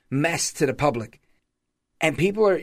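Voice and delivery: ordinary talk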